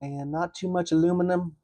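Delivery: mock Southern fry review. Friend playfully voicing the dad's accented fry praise with a Southern accent. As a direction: ham up a folksy food review. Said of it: "Were the voices talking to you".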